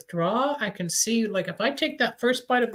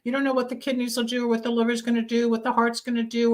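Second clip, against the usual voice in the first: usual speaking voice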